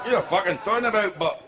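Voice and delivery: broad Scottish accusation; drunk Scotsman. Acted. Drunk Scot argues with a Welshman, thick Scottish accent. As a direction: challenge him in thick Scottish. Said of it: "imagine a Scotsman pissed".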